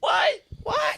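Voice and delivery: high-pitched excited interjections; hype-man shouts. Pretend. Co-host hypes up the episode announcement, high excited shouts.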